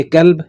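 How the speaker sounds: کلب with a thin k, "kalb"; plain kaaf. Shown as a demonstration. Teacher warning that meaning changes, کلب with plain k. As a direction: say کلب with a front k, not q. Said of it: "اگر کاف اب بلائیں گے".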